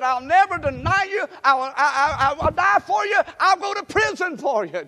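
loud, high shouted promise; Peter's vow. Acted. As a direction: declare it loud and high like an overeager disciple. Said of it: "Peter said".

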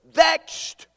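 "Vexed" high, loud, with a rising tune; stressed word. Shown as a demonstration. Preacher highlighting "Vexed" for his listeners, rising stress. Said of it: "What's the next word?".

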